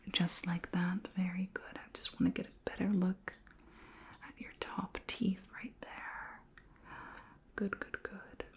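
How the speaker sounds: soft, breathy murmur while focused; hushed encouragement. Pretend. Alien examiner inspecting a human's top teeth, hushed and encouraging. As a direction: let the praise fade into a whisper.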